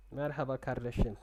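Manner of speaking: usual speaking voice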